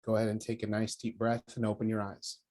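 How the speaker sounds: soft spa whisper. Acted. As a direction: gently bring them back. Ends the meditation.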